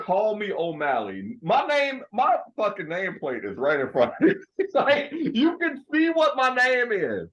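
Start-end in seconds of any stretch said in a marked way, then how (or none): 1.4-7.3 laughing mock outrage. Mock outrage at being misnamed.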